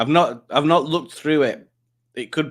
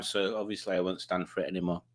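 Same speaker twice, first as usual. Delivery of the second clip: much softer and lower, dry joke; quiet deadpan aside; 6 semitones lower, 11 dB quieter than usual. Shown as a demonstration. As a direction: drop your voice for the punchline. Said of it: "Well, I'm sat down".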